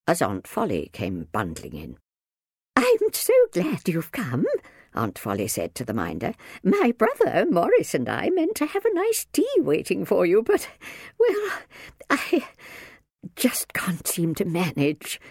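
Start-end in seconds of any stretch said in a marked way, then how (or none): 2.8-4.5 high-pitched gushing welcome; fluttery aunt, welcoming. A narrator's reading. Aunt Folly greets the minder, gushing. 6.6-15.2 very high, hesitant voice fading airily; fluttery aunt, flustered. A narrator's reading. Aunt Folly excuses the missing tea, flustered.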